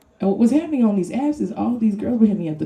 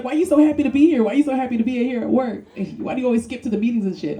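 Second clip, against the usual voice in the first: higher, mock-nagging coworker voice; nosy coworkers; 6 semitones higher than usual. Coworkers asking why so happy at work, mock nagging. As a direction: needle them like a coworker. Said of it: "People always like".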